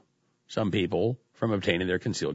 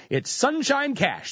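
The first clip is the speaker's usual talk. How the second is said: high, drawn-out sarcastic delight; mock cheery; 13 semitones higher, slower than usual. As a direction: gush it slowly in a much higher pitch. Sarcastic rosy renaming of dark money.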